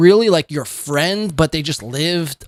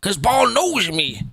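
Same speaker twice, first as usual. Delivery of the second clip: higher-pitched mock Southern accent; imitated Southern accent; 4 semitones higher than usual. Host mocking the streamer's put-on voice, higher Southern accent. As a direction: parody his slip into a Southern accent. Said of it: "this voice he's got going on here".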